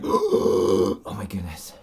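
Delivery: mock overwhelmed gift reaction; imitated gasping astonishment. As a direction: mimic someone gasping in amazement, drawn out. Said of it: "She was like".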